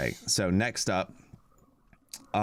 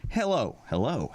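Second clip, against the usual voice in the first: voice-over takes; 5 semitones higher than usual. Shown as a demonstration. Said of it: "wasn't sure if I was supposed to do the three takes".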